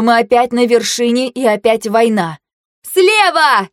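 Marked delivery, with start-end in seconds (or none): 2.8-3.7 high squeal of alarm; piercing battle call; shrieked warning. A narrator's reading. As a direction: shriek a direction to your team. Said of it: "визжала Фэй".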